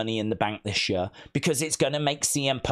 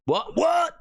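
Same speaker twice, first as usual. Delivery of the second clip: surprised; 3 dB louder than usual. Real, not acted. Surprise at chat comment.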